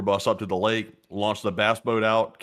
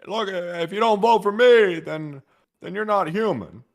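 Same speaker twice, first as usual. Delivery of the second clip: mock campaign threat; 10 semitones higher, 5 dB louder than usual.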